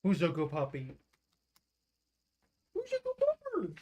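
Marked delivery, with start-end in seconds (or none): 2.7-3.7 high pet voice. Real, not acted. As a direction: coo it way up high to the dog. Talking to the dog.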